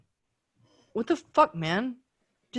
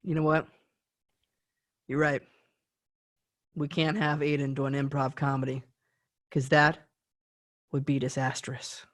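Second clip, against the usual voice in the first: sarcastic defeat; 8 semitones lower, 5 dB quieter than usual. Acted. Mock surrender, very low.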